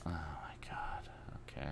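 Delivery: soft breathy whisper. Real, not acted. Whispered disbelief.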